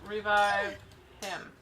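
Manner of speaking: ordinary talk, nothing marked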